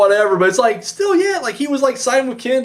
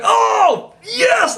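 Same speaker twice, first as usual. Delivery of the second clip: excited cheer. Retold or imitated. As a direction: cheer it out, thrilled. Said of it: "I was like".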